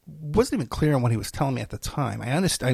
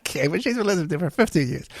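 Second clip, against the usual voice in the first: ordinary talk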